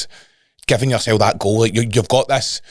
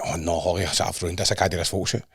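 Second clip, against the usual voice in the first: hushed, deflated panic, much softer; mock despair; 5 semitones lower, 9 dB quieter than usual. Telling his podcast friends how he panicked to Holly, hushed and defeated. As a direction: retell the panic in a soft defeated voice. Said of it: "And I'm like".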